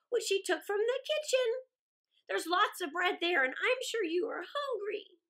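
a hint of a chattering crow, loud; eager crow passing on news; lightly voiced crow. Crow comforting barefoot Gerda, a lightly voiced bird.

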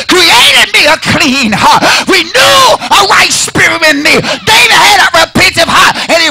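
high, loud, cracking preaching cry; crying whoop. Acted. Pastor crying out the psalm at the peak, whooping. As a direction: cry it out at the top.

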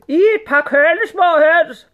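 loud, high, slow growling complaint; grumbling sultan. A narrator's reading. Sultan scolding the serving girls, loud grumbling. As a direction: grumble loudly and slowly as the sultan. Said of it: "brummede sultanen".

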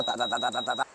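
soft rapid clicking sound; quiet mouth-made counter clicks; clicker imitation. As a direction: mimic a tally counter clicking away.

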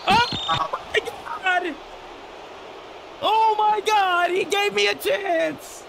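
stretched-out high disbelieving yells; excited shouting. Real, not acted. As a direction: yell it slowly in pure hype. Comeback chance in the game.

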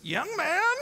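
put-on high, thin old lady calling out; old woman voice. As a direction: pipe up like an eighty-five-year-old woman. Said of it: "And she goes".

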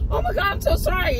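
high, rushed mock apology; put-on high voice, hurried and apologetic; apologetic other driver. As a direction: imitate a flustered stranger saying sorry. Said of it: "feeling horrible".

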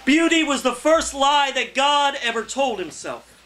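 poetry reading. Acted. Poet reading his poem to an open mic crowd, declaiming. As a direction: read it to the room like a performance poem.

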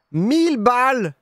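high, loud outcry at the price; a real shout of sticker shock; shocked outburst. Real, not acted. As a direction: yelp it out in disbelief.

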